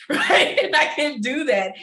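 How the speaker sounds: high, loud burst of real laughter; laughing. Real, not acted. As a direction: break into a laugh as you speak. Laughs mid-story about crying.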